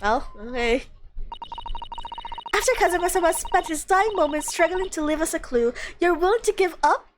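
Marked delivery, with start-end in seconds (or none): 2.6-7.1 higher-pitched character voice, indignant reproach; reproachful challenge. Acted. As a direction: challenge them in disbelief, rising at the end. Acted reproach in game dialogue.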